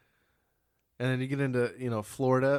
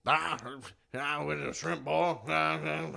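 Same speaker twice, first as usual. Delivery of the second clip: Ed Ogeron; 4 semitones higher than usual. Retold or imitated. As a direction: garble it like a loose-jawed Cajun coach. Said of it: "But Ed Ogeron, it's".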